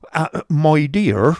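slow, warning husband; a hint of a frowning husband; lightly voiced Squeers. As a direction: rein in the wife slowly.

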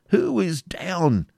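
high, drawn-out urgent question; crying out. A narrator's reading. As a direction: demand an answer, slow and high. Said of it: "cried Ivanhoe".

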